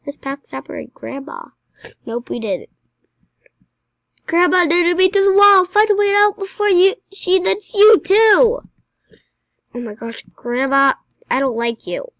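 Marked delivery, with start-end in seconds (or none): 4.3-8.6 high, loud shouted warning; urgent shouting. Real, not acted. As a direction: shout it like an alarm to the others. Urgent warning while fleeing game grandma.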